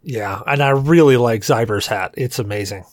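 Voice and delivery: ordinary talk, nothing marked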